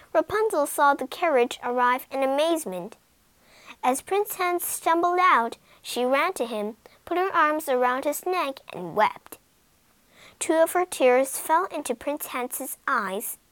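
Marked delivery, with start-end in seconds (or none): none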